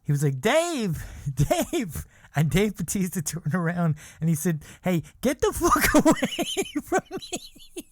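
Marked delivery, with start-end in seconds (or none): 0.4-2.1 calling out. Retold or imitated. Friend calling after celebrity. 4.8-7.8 high, slow, cracking up mid-line; can't keep a straight face while quoting him; laughing through the quote. Retold or imitated. Celebrity snapping at pursuer.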